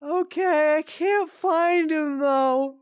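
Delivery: high, slow, tearful mom voice; imitating crying mom. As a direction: whimper it as the sleepwalking mom. Voicing sleepwalking mom.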